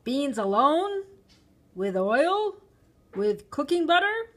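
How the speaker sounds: loud, rising impatient options; impatient shopkeeper, pitch climbing; impatient questioning. A narrator's reading. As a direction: rattle off each choice as a testy question. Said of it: "He asked me impatiently".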